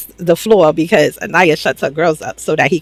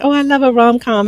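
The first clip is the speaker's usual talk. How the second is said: petty teasing; 6 semitones higher than usual. Retold or imitated.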